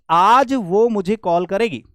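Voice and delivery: आज drawn out and loud, opening the clause; stress on आज. Shown as a demonstration. Teacher explaining today's call, strong stress on आज. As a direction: hit आज slowly, attaching it to call करेगी. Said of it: "today उसके लिए काम करने लगेगा".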